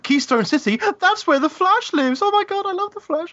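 a comic-book fan losing it with joy; gleeful fanboy. Retold or imitated. Game fan's gleeful reaction.

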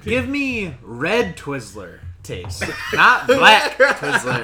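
slow, loud and laughing. Real, not acted. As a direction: laugh it out, rising high on the last words.

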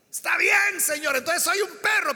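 high, loud pleading comeback; réplica insistente. Acted. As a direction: give in loudly, still begging. La mujer insiste ante Jesús. (respuesta suplicante en voz alta)

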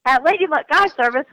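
high, overly polite customer-service voice; sweetly professional reply. Retold or imitated. Guide answering a rude commenter, in a sugary polite voice. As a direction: keep it chipper and businesslike while fuming underneath. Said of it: "and I was like".

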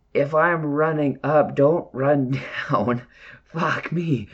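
slow, high, laughing exasperation; exasperated retort. Retold or imitated. Hiker to teammate who ran toward the bear, drawn-out exasperation. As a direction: spell it out slowly in mock disbelief.

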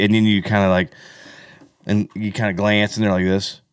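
usual speaking voice

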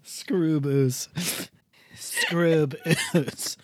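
hammed-up Canadian "oo" vowels; mock Canadian accent. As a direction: repeat it as a cartoon Canadian. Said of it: "jokes about the Canadian accent".

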